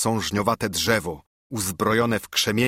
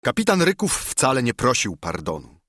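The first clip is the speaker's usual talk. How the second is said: usual speaking voice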